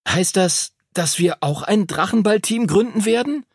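put-on high-pitched, eager boy's question; thrilled pupil, voice climbing high; hopeful excitement. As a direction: ask as if your wish may come true. Henry hopefully asks Duncan about team.

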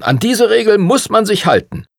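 high-pitched, insistent reprimand; exasperated prince laying down the law; indignant. A narrator's reading. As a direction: insist on the rule, annoyed. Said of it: "sagte der Principe ungehalten".

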